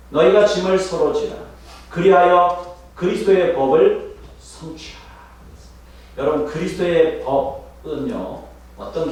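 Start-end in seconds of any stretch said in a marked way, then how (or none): 0.1-5.0 proclaimed scripture. Acted. Preacher proclaiming the verse to his flock, loud. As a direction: proclaim it like a command. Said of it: "이 절에".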